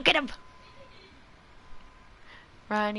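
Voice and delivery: normal talking voice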